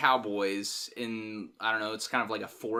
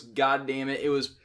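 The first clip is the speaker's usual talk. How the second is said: normal talking voice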